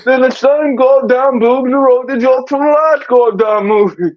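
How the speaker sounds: high, garbled rambling impression. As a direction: yelp the first word, then slur slowly on up high. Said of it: "the best [name] joke".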